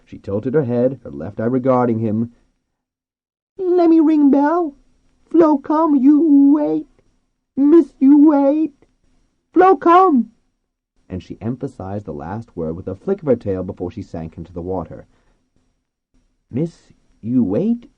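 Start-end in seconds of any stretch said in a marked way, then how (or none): 3.6-10.3 very high, loud, slow squeal; insistent dolphin. A narrator's reading. 16.5-17.9 "Miss, you wait?" slow, higher, rising at the end; puzzled repeating. A narrator's reading. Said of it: "Alemi repeated".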